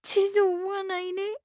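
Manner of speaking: put-on a resigned young maid. A narrator's reading. Meg's voice.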